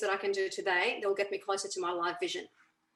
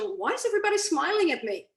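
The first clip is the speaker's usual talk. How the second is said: higher, louder, full of delight; delighted wondering question; 7 semitones higher, 5 dB louder than usual. Shown as a demonstration. Coach showing a happy affirmation question, delighted. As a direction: ask it like you can't believe your luck. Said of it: "ask a question".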